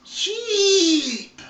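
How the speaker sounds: high, loud startled cry; surprised shout. Real, not acted. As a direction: blurt it out in sudden shock. Surprised to find sheep in pen.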